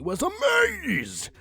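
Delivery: mock amazement. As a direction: stretch it out in fake awe. Reading with mock wonder.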